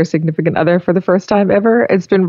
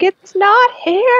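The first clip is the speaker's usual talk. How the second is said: higher, slow helpless whine; imitating mom; 13 semitones higher, slower than usual. Mom stuck with tech issues, mock helpless. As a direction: drag it out like a lost parent. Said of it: "She's like".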